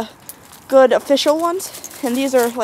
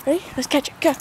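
normal talking voice

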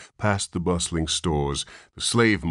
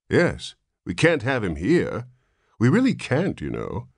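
firm nurse; 7 semitones higher, 3 dB louder than usual. A narrator's reading. Nurse insists firmly.

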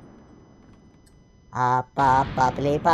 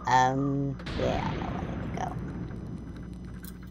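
ordinary delivery

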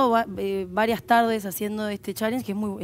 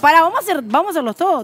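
louder, over the group; calling out; 5 semitones higher, 7 dB louder than usual. Real, not acted. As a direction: call everyone to order. Calling out during challenge.

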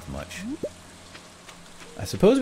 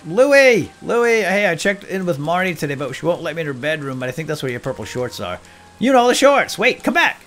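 high, loud shout across the street; excited loud call after a passerby; 6 semitones higher, 7 dB louder than usual. Pretend. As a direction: hail him before he leaves. Calling out to game character.

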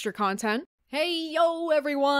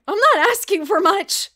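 loud, high plea; exasperated shout; 8 semitones higher, 11 dB louder than usual. Real, not acted. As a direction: cry it out in frustration.

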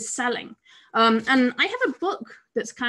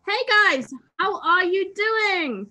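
bright greeting; 7 semitones higher, slower than usual. Real, not acted. Cheery stream greeting.

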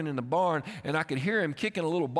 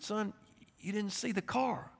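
soft, slightly higher, hushed explanation; gentle fatherly talk; 3 semitones higher, 7 dB quieter than usual. Retold or imitated. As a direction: speak tenderly to your shaken child. Shaken father talks gently to son.

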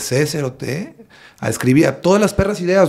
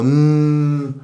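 lazy closed-mouth "mm" in an odd voice; drunken hum. Retold or imitated. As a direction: hum back, playing a man who has been drinking. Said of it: "le hago". ("mm" de borracho imitado)

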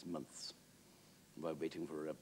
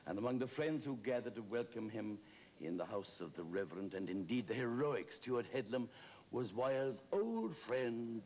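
normal talking voice

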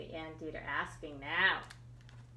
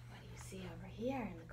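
whisper; 10 dB quieter, much faster than usual. Real, not acted. Reader whispers to children.

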